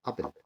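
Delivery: أبل starting on a short, open "a". Shown as a demonstration. Teacher reviewing at the board, short A in أبل. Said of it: "شورت آ".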